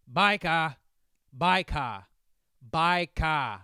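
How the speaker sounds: insistent repeated British shout; old British man yelling. Retold or imitated. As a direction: warn someone ahead like an elderly Brit. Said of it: "in his old man British accent".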